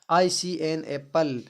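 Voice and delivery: very slow, drawn-out reading repeated twice. Shown as a demonstration. Teacher reading the worked example very slowly. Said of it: "जैसे दिया है".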